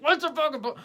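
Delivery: high, loud cry cutting in; acted blurt of lost patience; exasperated outburst. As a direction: burst in impatiently, voice leaping up. Acted impatient interruption of the rambler.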